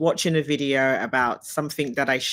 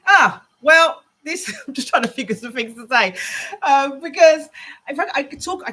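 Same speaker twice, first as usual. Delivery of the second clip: laughing; 11 semitones higher, 6 dB louder than usual. Real, not acted. Laughing at own self-interview.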